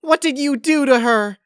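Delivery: high, loud tearful accusation; crying. A narrator's reading. Pinkie accuses Twilight, crying. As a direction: sob out the accusation. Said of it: "She was crying".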